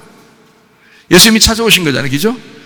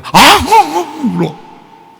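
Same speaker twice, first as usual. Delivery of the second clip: much higher, drawn-out mock wail; imitated sobbing; 12 semitones higher, much slower than usual. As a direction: act out the sobbing in a slow wail. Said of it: "엉엉엉 울다". (높고 길게 흉내 낸 울음소리)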